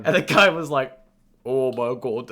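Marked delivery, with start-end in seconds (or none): 1.5-2.3 imitated Forest accent. Retelling a greyhound owner losing control, Forest accent. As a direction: put on a local Forest accent. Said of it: "That's my forest accent".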